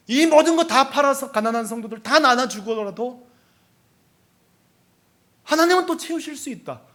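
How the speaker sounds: sustained high-pitched pulpit fervour; impassioned preaching. Real, not acted. Pastor ready to sell everything for the poor, impassioned preaching.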